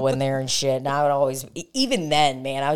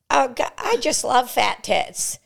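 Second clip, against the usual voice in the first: high silly pet voice; cat voice; 9 semitones higher than usual. Acted. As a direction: squeak it like the cat talking. Voicing the cat.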